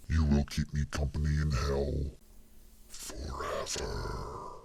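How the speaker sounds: deep whisper, forever drawn out slow and fading; trailing demonic whisper. A narrator's reading. Damien condemns the intruder to hell, last word trailing away. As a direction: let the last word stretch endlessly into nothing. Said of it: "The last word seemed to trail off".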